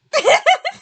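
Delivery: high, loud giggling laugh; high giggle. Acted. Character giggling at his own joke, loud high giggle.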